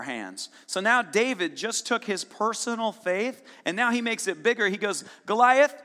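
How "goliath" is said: calling out. Acted. David calls out to Goliath.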